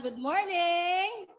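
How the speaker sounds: slow, high, sung-out good morning; drawn-out happy church greeting; cheery greeting. Shown as a demonstration. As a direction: show them how to greet warmly and slowly. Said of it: "Sabihin mo lang".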